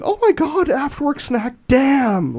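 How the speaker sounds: high-pitched, hammed-up excited outburst; mock freaking out. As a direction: imitate an overexcited fan losing it. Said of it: "Alex is freaking out in the YouTube chat".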